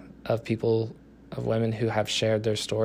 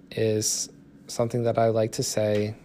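ordinary talk

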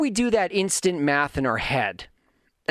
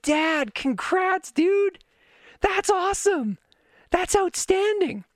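much higher, bright cheering praise; excited congratulations; slower than usual. Retold or imitated. Son cheering his dad after his first workout, excited and high. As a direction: cheer him on like a proud son.

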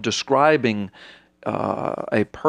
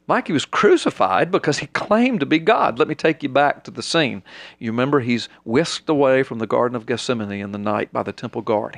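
ordinary delivery

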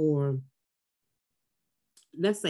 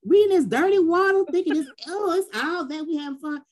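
mocking her old self; 10 semitones higher, 5 dB louder than usual. Mocking her naive younger self.

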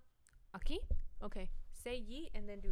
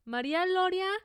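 loud call of the name; 8 semitones higher, 6 dB louder than usual. Pretend. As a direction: call her name across the room. Calling out a name.

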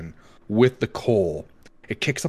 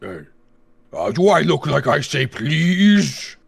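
higher, louder snooty monster; indignant monster voice; 4 semitones higher, 4 dB louder than usual. Acted. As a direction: scoff like a proud beast. Monster retorts to players.

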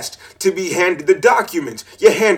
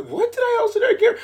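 squeaky puzzled; 5 dB quieter, much faster than usual. Real, not acted. High-pitched self-questioning.

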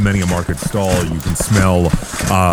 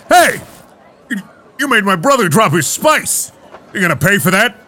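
threatening big brother; 8 semitones higher than usual. Acted.

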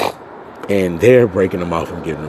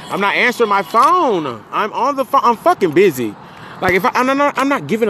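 exasperated yelling; 12 semitones higher than usual. Real, not acted. Yelling about phone while busy.

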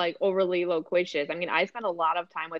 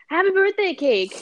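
put-on high, eager little-boy blurt; toddler voice; 11 semitones higher, 4 dB louder than usual. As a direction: pipe it up like a tiny kid bursting out. Mom imitating son's non sequitur.

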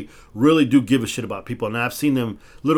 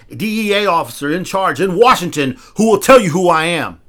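higher, louder furious warning; angry threat; 5 semitones higher, 5 dB louder than usual. Retold or imitated. To the cop stopping him, a raised, louder angry warning. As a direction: lay down a heated threat. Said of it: "honestly, I got pissed off by that".

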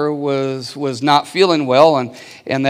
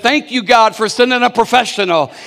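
louder and higher hammed-up thanks to God; mock grateful cry; 5 semitones higher, 5 dB louder than usual. Pastor voices the mom falling to her knees in mock thanks, higher and louder. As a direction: throw the punchline up to heaven.

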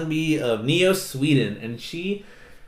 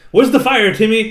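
loud, higher joking call-out; mock alarm call; 8 semitones higher, 8 dB louder than usual. Mock hero answering alarmed kid.

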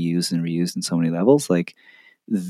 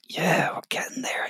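excited hushed whisper; 10 semitones higher, 8 dB quieter than usual. Retold or imitated. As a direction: whisper it like a secret thrill. Quoting inner excitement.

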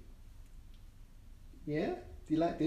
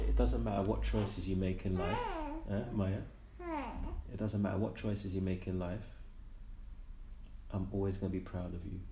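tender; 11 semitones lower, 7 dB quieter than usual. Real, not acted. Tender promise to his child.

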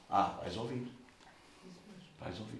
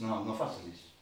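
calm king; 8 semitones lower, 10 dB quieter than usual. Retold or imitated. David quoted calmly.

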